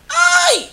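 loud, very high-pitched "ai" cry; high exclaiming yelp. Real, not acted. Guest erupting mid-rant to the host, high yelp. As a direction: let out a sudden piercing yelp. Outburst in a heated exchange.

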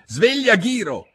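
high, loud wake-up call; sharp high holler; shouted. A narrator's reading. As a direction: yell to rouse the sleeper. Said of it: "urlarono insieme gli altri due".

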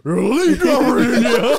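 loud laughing yell of elite driver; loud mocking shout. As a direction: shout it back through laughter. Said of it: "mocking the yelled boast".

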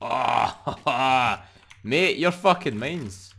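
loud high laughing, slow on the last words; laughing gloat. Real, not acted. Gamer gloating over a supply drop, laughing loudly. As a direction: crow over the loot with a laugh.

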